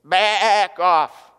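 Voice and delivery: punchline voice of a sheep talking back; tough sheep. Retold or imitated. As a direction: bark it like a tough guy sheep. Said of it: "They go".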